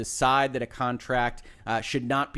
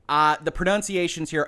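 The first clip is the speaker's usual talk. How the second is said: usual speaking voice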